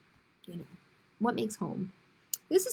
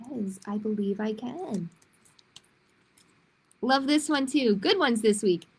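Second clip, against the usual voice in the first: ordinary delivery, nothing marked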